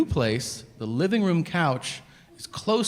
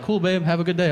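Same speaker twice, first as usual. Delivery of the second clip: breezy husband; 5 semitones higher, faster than usual. Retold or imitated. Husband brightly brushes off wife's jab.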